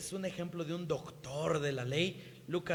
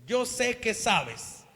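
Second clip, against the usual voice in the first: knowing challenge; 4 semitones higher, 7 dB louder than usual. Acted. Jesus answers the scholar, loud and knowing. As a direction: let him know you see through him.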